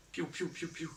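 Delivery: pew pew sound effects. Acted. Imitated blaster sounds.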